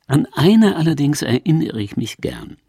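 usual speaking voice

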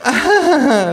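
very high, loud forced laugh; fake laugh. Hostess laughs artificially at the kangaroo's joke, forced. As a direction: laugh falsely, too bright. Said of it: "lacht die Frau künstlich".

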